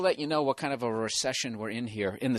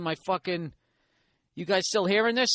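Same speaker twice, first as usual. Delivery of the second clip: ordinary talk, nothing marked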